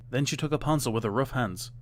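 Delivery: ordinary delivery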